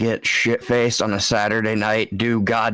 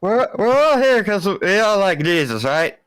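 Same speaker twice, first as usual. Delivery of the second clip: put-on blackout drunk; 8 semitones higher than usual. Drunk churchgoer rambling at church.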